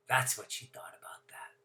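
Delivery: soft, breathy aside whisper. Acted. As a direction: whisper it like a sly secret. Punchline after Pow.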